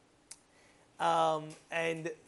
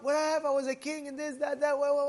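high-pitched mock bewilderment; bewildered protest; 7 semitones higher, 3 dB louder than usual. Dethroned king waking up to find it all gone, bewildered. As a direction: act out a baffled man protesting, pitched up high.

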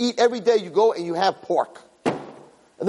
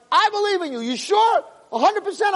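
very high, animated exchange; fervent assurance. Retold or imitated. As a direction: act out mounting conviction between friends. Escalating exchange with the friend.

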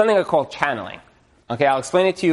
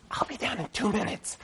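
quiet aside; 7 semitones higher, 12 dB quieter than usual. Pretend. Wife's aside, much softer.

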